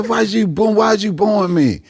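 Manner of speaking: high, exasperated demand; indignant pleading. Retold or imitated. Comic to his heckler, high indignant protest. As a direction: complain high and stretched out. Demanding why she boos him.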